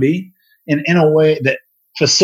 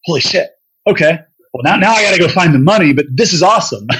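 higher, louder, fast and thrilled; excited inner voice; 5 semitones higher, 4 dB louder than usual. Retold or imitated. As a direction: blurt out the thrill you kept inside. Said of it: "but inside I was like".

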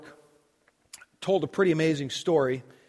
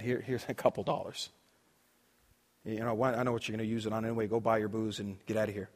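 softer, lower, curt and contemptuous; dismissive brush-off; 7 semitones lower, 10 dB quieter, faster than usual. Retold or imitated. Preacher brushing off the homeless man, dismissive and softer. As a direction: wave him off quickly, rushing the middle.

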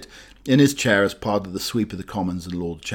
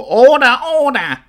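very high, loud squawked order; high squawking parrot call; 8 dB louder than usual. Acted. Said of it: "a strange voice calling for".

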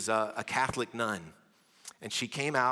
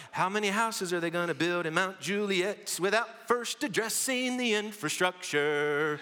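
mock complaint; 7 semitones higher than usual. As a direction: play a fed-up local griping. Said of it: "All right, like".

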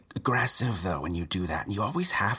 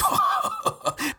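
incredulous laugh. Acted.

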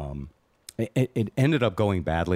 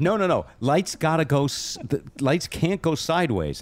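exasperated insisting; 9 semitones higher than usual. Retold or imitated. Boss insisting to workers on lights.

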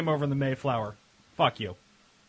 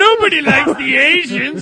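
mock sound bite shout; 8 semitones higher, 7 dB louder, slower than usual.

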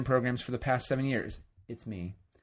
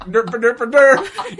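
high, loud goofy derp; mock derp voice; 8 semitones higher, 13 dB louder, much slower than usual. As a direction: yell the derps like a fool. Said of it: "I was like".

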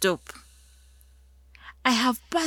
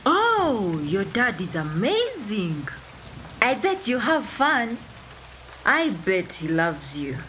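gushing admirer. Acted. People praising her dad, gushing mock admiration. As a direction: gush like an envious outsider, pitch leaping up. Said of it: "I pity people who say".

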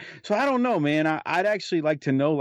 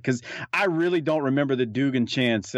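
normal talking voice

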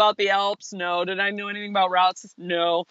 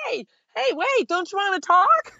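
much higher, playful pleading call; needy call after him; 13 semitones higher than usual. Retold or imitated. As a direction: squeak after him as he leaves. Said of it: "And I'm like".

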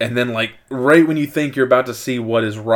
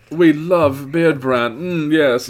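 louder, drawn-out mock British; British accent; 4 semitones higher, 5 dB louder, slower than usual. Host praising the beard sponsor, gushing British accent. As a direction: gush in hammed-up British. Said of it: "break out into a more Irish".

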